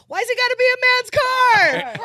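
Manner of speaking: high, loud indignant shout; indignant yell. Retold or imitated. Yelling back at the cop, indignant shout. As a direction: fire it back at the cop, outraged. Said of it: "So we're yelling at each other now".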